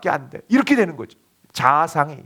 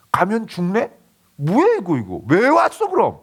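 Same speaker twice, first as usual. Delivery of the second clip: grumbling complaint; 4 semitones higher than usual. Retold or imitated. Preacher mimics spies grumbling, whiny grumble. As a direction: sulk and complain, lips pushed out. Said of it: "막 투덜투덜하고 막 입이 이렇게 나오는".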